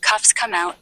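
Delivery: ordinary talk, nothing marked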